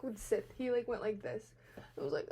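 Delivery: ordinary talk, nothing marked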